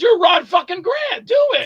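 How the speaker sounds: high, shouted pep talk; mock rallying shout; hyped-up director. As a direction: imitate a director yelling encouragement, pitch shooting up. Acts out director hyping an actor.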